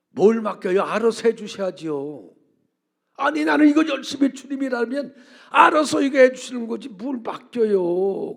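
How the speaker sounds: loud, high and exasperated; heated arguing. Retold or imitated. Pastor insisting to God, loud and exasperated. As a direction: argue back as if it's obvious. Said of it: "막 또 따졌어요".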